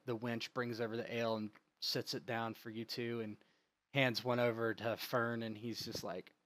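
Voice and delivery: ordinary talk, nothing marked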